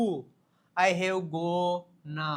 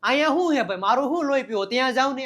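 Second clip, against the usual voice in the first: mock irritation; 5 semitones higher, 6 dB louder, much faster than usual. Acted irritated sending someone away.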